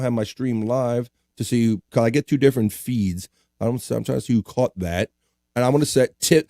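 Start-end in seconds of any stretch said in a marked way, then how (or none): none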